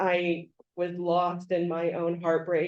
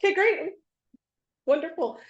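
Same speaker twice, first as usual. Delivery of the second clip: high, loud, amused enthusiasm; mock enthusiastic reply; 12 semitones higher, 6 dB louder, faster than usual. Replying to a friend's random call, amused high enthusiasm. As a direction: answer with playful over-the-top delight.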